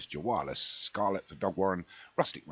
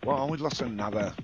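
excited; 5 semitones higher, 5 dB louder than usual. Real, not acted. Third faller in race call.

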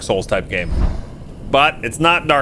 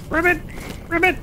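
frog croak; 3 dB quieter than usual. Pretend. Imitating a frog.

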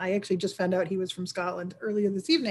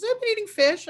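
much higher, breezy mock-innocent wife; Faith's excuse; 13 semitones higher than usual. The host imitates Faith telling Goody Wakely where she was, high and innocent. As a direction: toss off the excuse in a high put-on voice.

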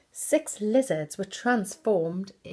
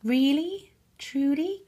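high and loud, breathless with wonder; gasping surprise; 8 semitones higher, 6 dB louder than usual. Acted. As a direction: gasp it out in amazement, much higher and louder. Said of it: "gasped Cinderella".

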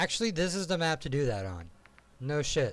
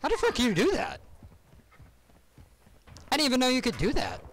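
shocked outburst; 11 semitones higher than usual. Real, not acted. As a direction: yelp it out, stunned by how he died. Shocked at enemy kill.

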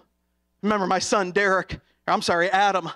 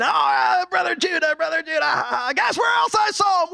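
a cheeky puppet teasing a crowd, much higher; puppet routine; 8 semitones higher than usual. Retold or imitated. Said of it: "he went on with his little routine".

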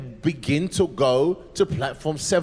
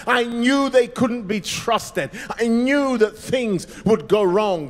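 higher, bitter declaration; angry; 5 semitones higher, 4 dB louder than usual. Acted. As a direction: she saw this coming and is furious. Angry woman storming off.

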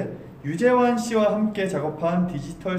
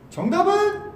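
game show host; 13 semitones higher than usual. Acted. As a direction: build suspense like a quiz host. Quiz host asks for answer.

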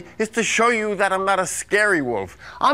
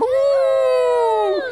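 wolf howl; 13 semitones higher than usual. Pretend. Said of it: "every time I say lamb".

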